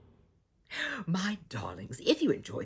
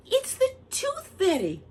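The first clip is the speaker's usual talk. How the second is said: excited reveal; 9 dB louder than usual. Acted. Excited phone answer.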